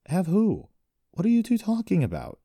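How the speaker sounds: high, loud, incredulous questions; baffled protest. A narrator's reading. Accused brother to his two accusers, baffled protest. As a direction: demand to know what they mean.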